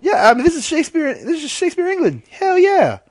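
high-pitched gleeful cheer; excited high voice. Acted. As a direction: squeal it with glee. Excited high voice about Shakespeare.

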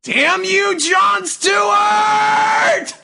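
slow, high, drawn-out wrestling shout; dramatic shout. Acted. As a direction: curse the name to the sky. Dramatic cursing of rival.

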